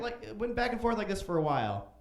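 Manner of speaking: ordinary talk, nothing marked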